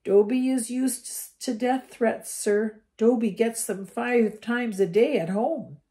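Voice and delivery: high, slow, resigned elf voice; a meek house elf answering Harry; weak house elf. A narrator's reading. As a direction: shrug off the threats like a meek elf. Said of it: "Dobby smiled weakly".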